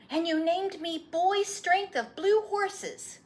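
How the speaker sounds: put-on high, eager child voice; a little boy pleading for the story; young boy. Boy asking his grandfather to retell his birth, eager child voice.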